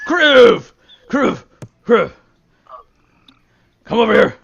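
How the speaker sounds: loud, high call across the room. Acted. Sober man calling Kriv over to his family. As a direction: shout the name to get his attention.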